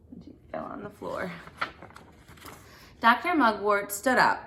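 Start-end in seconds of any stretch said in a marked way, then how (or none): 0.1-1.3 whispered aside. Real, not acted.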